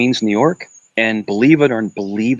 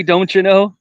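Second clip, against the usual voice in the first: a higher, sing-song Upper Midwest "Don't you know"; mock Minnesota accent; 8 semitones higher than usual. Host teasing a caller about Midwest speech, mock Minnesota accent. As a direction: ham up the Fargo-style accent. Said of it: "Does he say".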